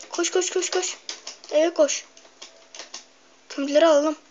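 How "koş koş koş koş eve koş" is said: being chased. Real, not acted. Hurrying home with coal.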